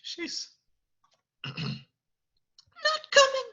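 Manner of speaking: stammering, high-pitched and hesitant; stall, stutter, then squeak it out; Cogsworth, very nervous. Acted. Nervous servant breaking bad news to Beast, timid stammer. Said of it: "Cogsworth says".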